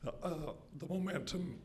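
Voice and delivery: strained high voice, half laughing; choking. Real, not acted. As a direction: push words out while choking. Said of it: "sorry, I'm choking".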